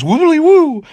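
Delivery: high, loud silly incantation; mock spell shout. Cocky wizard throwing random hand gestures, mock spell shout. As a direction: holler it like a nonsense spell. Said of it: "and shouts".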